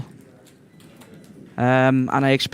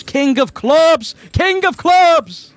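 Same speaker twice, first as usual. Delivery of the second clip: high, loud, drawn-out cry of delight; excited shout; 12 semitones higher, 6 dB louder, much slower than usual. Real, not acted. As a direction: yell it out as the card lands. Commentator reacts to a flush card.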